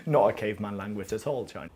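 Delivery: ordinary delivery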